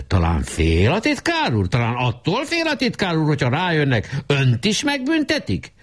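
mocking stern challenge rising high; stern questioning. A narrator's reading. Weiss sternly challenging the secretary, rising high. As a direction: needle him sternly, rising as it goes. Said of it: "Weiss szigorúan kérdezte".

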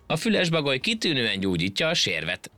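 high-pitched impudent jibe; cheeky. A narrator's reading. Ant taunting the straining monkey, cheeky. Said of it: "szemtelenkedett a hangya".

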